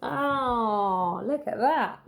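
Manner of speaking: usual speaking voice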